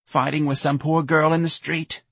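high, mournful mother voice; sorrowful, disappointed mom; sad. Acted. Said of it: "Missus Riley said sadly".

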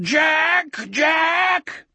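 very high, sustained howling cries. A narrator's reading. Wolf desperate for Jack's help, howling. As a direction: howl each name long, held high. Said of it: "he howled".

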